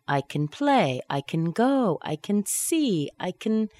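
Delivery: "can" weakened to "kn", verb stressed; reduced can. Shown as a demonstration. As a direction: swallow CAN and land on the verb. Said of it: "can is usually reduced to".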